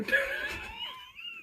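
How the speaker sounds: high helpless laugh; a sudden giggling fit; burst of laughter. Real, not acted. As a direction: crack up mid-sentence. Reader breaks up mid-story.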